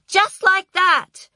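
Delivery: very high, loud, drawn-out screech; screeched with satisfaction. A narrator's reading. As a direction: screech it in vindication. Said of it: "screeched the P A with satisfaction".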